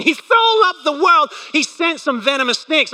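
high, incredulous mock quote. Preacher mocking the snake verse, high disbelief. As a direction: lift it up in disbelief.

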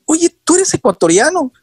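high-pitched, incredulous retold question; astonished question. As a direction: ask it as if you can't believe it, pitched right up. Acted astonished question to the man. (pregunta asombrada, con voz aguda)

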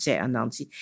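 ordinary delivery, nothing marked

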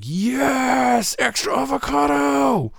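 high, bright cheer over extra avocado; mock cheer. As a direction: celebrate a topping like a win. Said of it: "are these guys like".

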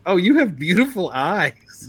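lightly voiced woman flirting; cringey flirty lady. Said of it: "She goes".

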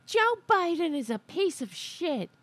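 mock her shrill rant between gym sets; mocking Greene. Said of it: "in between sets going".

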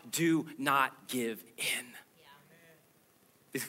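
very slow hushed whisper. Acted. God's plea ends in whisper.

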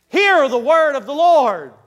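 high, loud command to listen; ringing preacher's cry; prophetic proclamation. Acted. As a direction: proclaim it to the whole land. Prophet's cry carried on after "land.

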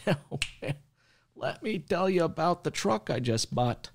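high, slow, gushing buyer voice; hammed-up satisfied truck buyer; mock happy customer.

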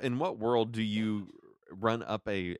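ordinary delivery

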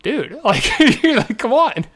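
giggly, high-pitched come on; laughing disbelief. Retold or imitated. Laughing at opponent's mistake.

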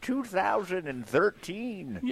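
put-on slow, high old-time announcer; old newsreel narrator. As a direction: draw it out like a newsreel. Continues the old-timey bit.

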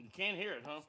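ordinary talk, nothing marked

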